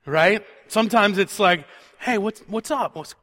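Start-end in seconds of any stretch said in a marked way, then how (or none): 2.0-3.1 an interested "Hey, what's, what's up?", turning toward them; lightly acted friendly hello; engaged greeting. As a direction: greet them like you actually want to talk. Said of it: "you're more engaged".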